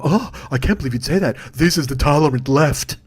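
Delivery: hammed-up sanctimonious shock, slowing down; mock them being wounded by bad language; pretend offense. Critics pretending the language bothers them, fake-aghast. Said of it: "pretend that the language bothers them".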